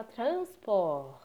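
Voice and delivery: "transport" with the R scraped in the throat; "transport" said slowly in French; French throaty R. Shown as a demonstration. Teacher models how the French say "transport". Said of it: "O francês fala".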